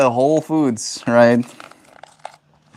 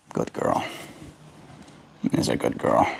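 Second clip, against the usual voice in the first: hushed, low murmur; soft pet praise; 14 semitones lower, 12 dB quieter than usual. Real, not acted. Owner praising bird for stepping up, hushed murmur.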